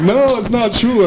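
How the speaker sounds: stunned denial. Retold or imitated. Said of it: "I'm like".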